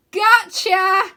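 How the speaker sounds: put-on high, slow and loud goofy boy; calling down in a big high cartoon voice; bright playful wizard-boy voice; Wyn's voice. A narrator's reading. Wyn reveals joke.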